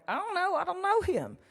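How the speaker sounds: high, panicky protest; flustered panic. Retold or imitated. Panicked reply on the bus.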